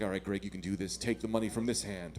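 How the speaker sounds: low, soft self-coaching; a low muttered pep talk to himself. Retold or imitated. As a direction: quietly talk yourself through it. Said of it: "And I was like".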